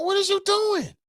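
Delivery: confronting John about the photos, high-pitched; high incredulous question. Retold or imitated. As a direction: shoot it up in shocked disbelief. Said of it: "I walked over to him like".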